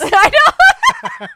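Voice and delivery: very high, loud laughing "I know"; laughing mock reply; laughing squeal. Laughing reply in retold scene.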